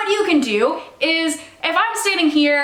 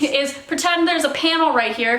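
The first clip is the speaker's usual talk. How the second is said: usual speaking voice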